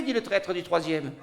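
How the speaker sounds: ordinary delivery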